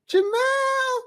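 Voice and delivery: very high yelled name; parents screaming. Retold or imitated. Parents yelling for their oldest son, very high shout. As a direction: scream the name through the house. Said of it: "my parents would scream my name".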